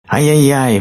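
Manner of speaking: high, loud "ai-yai-yai"; storybook bear cub's excited cry; full-throated acted yell. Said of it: "во всё горло закричал".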